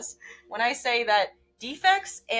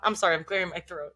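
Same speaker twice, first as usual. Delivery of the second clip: normal talking voice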